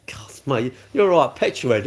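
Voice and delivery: normal talking voice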